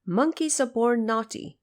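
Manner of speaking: normal talking voice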